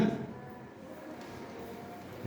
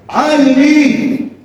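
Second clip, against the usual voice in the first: high, long-drawn lament; prophet's drawn-out lament; cry of woe; 8 semitones higher, much slower than usual. Acted. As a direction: draw out a high, woeful cry.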